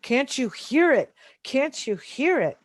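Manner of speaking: half-voiced retold outcry; retold screaming plea. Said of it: "holding my hands over my ears and screaming".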